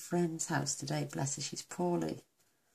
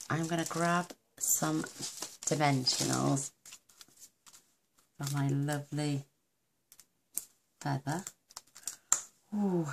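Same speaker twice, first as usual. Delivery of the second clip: ordinary delivery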